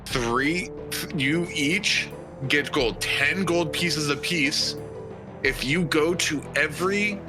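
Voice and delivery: usual speaking voice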